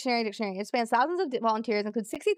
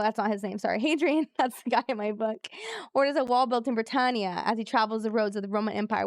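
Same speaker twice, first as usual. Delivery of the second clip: ordinary talk, nothing marked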